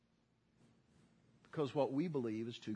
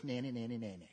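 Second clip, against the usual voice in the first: put-on lightly sung childish nanny-nanny, fading softer; mock taunt; 2 semitones lower, 5 dB quieter than usual. Brothers taunting Joseph, softly sung mock nanny-nanny. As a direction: tease like a kid on the playground. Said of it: "Not exactly the way they said it".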